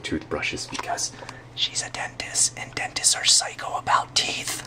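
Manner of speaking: conspiratorial whisper aside. Real, not acted. Whispering a teasing aside about his dentist wife, hushed.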